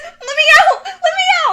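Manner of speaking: high loud panicked yell; screaming to be let out. Retold or imitated. Girl trapped in a blanket burrito screams at her cousin, high and loud. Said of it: "I'm screaming".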